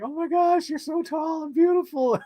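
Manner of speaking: high squealing gush; starstruck fan. Retold or imitated. Fan meeting actress at expo, squealing high gush. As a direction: gush like a giddy fan meeting her idol. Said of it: "I was like".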